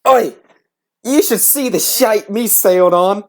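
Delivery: mock British pirate, slow; a slow, hammed-up British pirate jeer; British accent. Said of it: "I don't know why I'm making it British".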